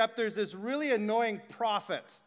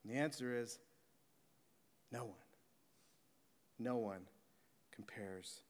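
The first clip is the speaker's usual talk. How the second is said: hushed sermon; 9 semitones lower, 9 dB quieter than usual. Acted. Preacher hushing the crowd under the stars, awed and soft. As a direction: draw the room in with a hush.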